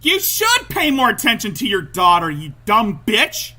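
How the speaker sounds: real angry outburst, slowing onto the insult; getting heated. Real, not acted. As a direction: lay into a neglectful parent, heated and loud. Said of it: "I got a little heated".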